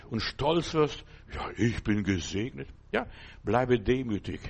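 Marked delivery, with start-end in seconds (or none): 1.3-2.7 mock proud believer.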